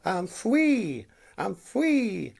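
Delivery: fwee with w for r, high and loud; says w for r. Real, not acted. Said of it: "I can't pronounce my R".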